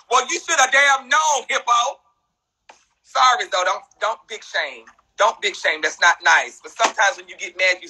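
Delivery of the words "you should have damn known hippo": ordinary talk, nothing marked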